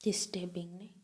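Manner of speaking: a single hushed whispered word; soft unvoiced whisper. Real, not acted. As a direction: breathe the word out in dismay. Reacting to the case details.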